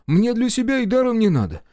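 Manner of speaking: high, ingratiating character voice; eager supply officer. A narrator's reading. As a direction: plead your selflessness in a high pitch. Officer plays selfless provider. (угодливый интендант, высоким голосом)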